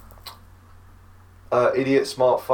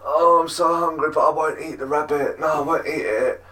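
whining vegan; 5 semitones higher than usual. Retold or imitated. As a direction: imitate a hungry, stubborn whiner. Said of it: "this vegan was like".

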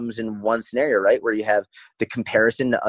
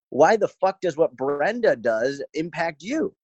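higher-pitched, drawn-out incredulous challenge; exasperated scolding; 5 semitones higher, slower than usual. Retold or imitated. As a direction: challenge the client slowly in a raised pitch. Acted scolding of discouraged client.

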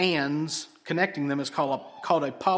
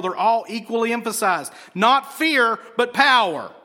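forceful pulpit delivery, building louder and higher; emphatic preaching; 4 semitones higher, 5 dB louder than usual. Real, not acted. Preacher tells congregation all are equally emphasized, in forceful, building preaching. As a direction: drive each word home, weighting every "and".

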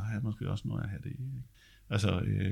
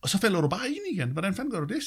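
a lightly voiced amazed conductor, pitched higher; the conductor marvels, voice raised in pitch; imitating the conductor; 9 semitones higher, 4 dB louder, faster than usual. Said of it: "tit sagde min dirigent der".

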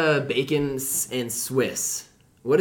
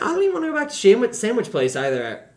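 fast, high and fed up; high exasperated outburst; 8 semitones higher, 4 dB louder than usual. Acted. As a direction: blurt it out, exasperated. Bursts out changing plans.

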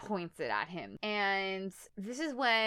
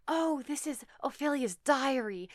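higher, mannered woman reading a diary aloud; character reading aloud; 6 semitones higher than usual. Retold or imitated. Wife announces the diary she found, put-on higher voice. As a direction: put on a higher, dramatic voice for the find. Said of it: "She's all like".

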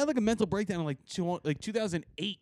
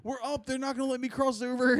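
higher, urgent wife on the phone; a hint of a demanding wife; wife calling him to hurry; impatient wife; 6 semitones higher than usual. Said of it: "it's my wife, she's like".

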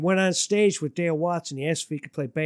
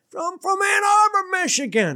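emphatic; 4 dB louder than usual. Real, not acted. Stressing hometowns.

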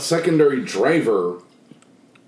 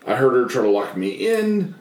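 ordinary delivery

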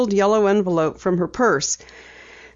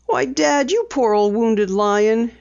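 gently teasing, a touch slower; chiding; 4 semitones higher, slower than usual. A narrator's reading. Daughter teases her grumpy father, light chiding. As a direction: poke fun at him fondly. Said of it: "she chided".

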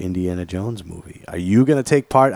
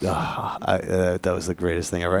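ordinary delivery

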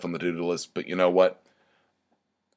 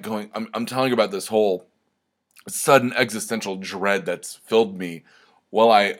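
ordinary talk

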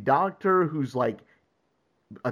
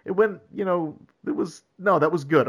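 halting, higher-pitched mock reluctance; retold sheepish admission; 5 semitones higher than usual. Podcaster voices hero back with the kitten, sheepishly hesitant. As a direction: stumble awkwardly into admitting it, pitched up.

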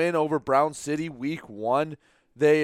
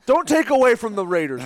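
laughing; 9 semitones higher, 4 dB louder than usual. Real, not acted. Teased by co-host, laughing.

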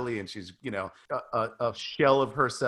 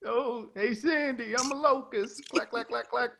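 high-pitched goofy movie-star impression, as a locust; hammed-up high voice of a singing insect celebrity; John Travolta; 12 semitones higher than usual. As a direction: do a silly high star impersonation. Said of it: "here's John Travolta being a locust".

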